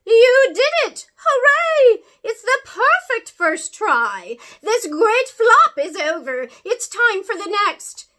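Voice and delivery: great-aunt cheering the crash, laughing and crying; joyful crying cheer. Acted. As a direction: shout it out in tearful delight. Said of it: "started to cry".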